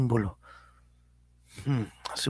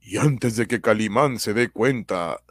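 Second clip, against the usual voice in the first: slow dramatic cliffhanger narration; old radio serial announcer; 2 semitones lower, 5 dB louder, slower than usual. A narrator's reading. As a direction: tease the next episode slowly.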